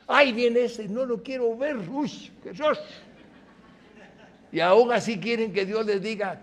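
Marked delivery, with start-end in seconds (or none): 0.1-2.8 high, whiny grumbling voice; lampooning a resentful believer who avoids a person; mocking grumbler. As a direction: mimic a sulky churchgoer dodging someone.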